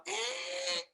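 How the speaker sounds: thin high creak of a gate; imitated gate noise. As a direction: squeak like iron gates opening, soft and high. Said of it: "you'd hear 'em go".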